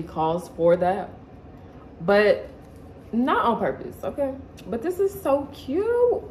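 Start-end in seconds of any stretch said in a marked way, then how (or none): 4.7-6.2 excited. Real, not acted. Delighted at a crop top.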